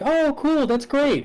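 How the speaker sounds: very high, sing-out mom voice; polite approving mom. Acted. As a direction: put on a high, overly pleased mother. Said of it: "and the mom's like".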